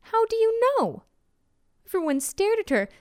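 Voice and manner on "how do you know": much higher, swooping down at the end; emphatic challenge. A narrator's reading. Teacher repeats it to the staring crowd, emphatic challenge. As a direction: press the question hard from a high pitch.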